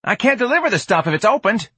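put-on high street-kid voice; alarmed protest. George protests the opened package, alarmed.

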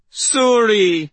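high, loud, drawn-out cry; stretched-out wail of the name in panic. A narrator's reading. Old woman calling to the mystic for help, crying out. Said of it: "Maeve cried".

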